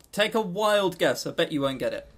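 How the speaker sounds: ordinary delivery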